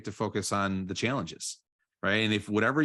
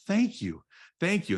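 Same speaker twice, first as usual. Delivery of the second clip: higher, lifted and delighted, growing brighter; rising joy; 9 semitones higher than usual. Shown as a demonstration. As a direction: let the gratitude lift you up, higher each time. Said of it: "And then I'm just gonna say".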